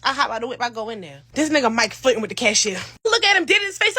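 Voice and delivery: usual speaking voice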